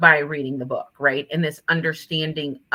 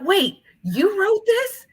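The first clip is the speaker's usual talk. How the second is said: high-pitched, stretched-out disbelieving question; incredulous surprise; 4 dB louder, slower than usual. Retold or imitated. Kids finding out their teacher wrote the book, incredulous. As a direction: imitate astonished kids, drawing it out. Kids amazed she is author.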